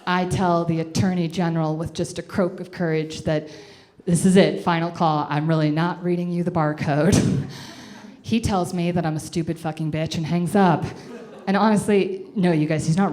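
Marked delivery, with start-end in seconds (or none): none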